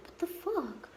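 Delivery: high-pitched retold curse of disbelief; shocked outburst. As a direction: fling it out in sudden disbelief. Retold outrage at the nurse.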